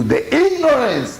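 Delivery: "ignorance" lifted high and drawn out slowly; emphatic. Real, not acted. Rabbi decrying ignorance to his listeners, stressing the word. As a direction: lean hard on "ignorance", slowing down.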